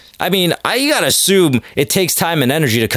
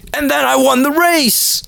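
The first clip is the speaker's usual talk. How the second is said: much higher put-on bragging voice; high-pitched mock punchline; 13 semitones higher than usual. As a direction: deliver the fake punchline way up high. Mock punchline from a winner comedian.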